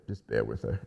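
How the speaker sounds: low, soft imitation of the husband; retold murmured aside; husband's quiet aside. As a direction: drop low and hushed, excusing her. Husband's aside, voiced by teller.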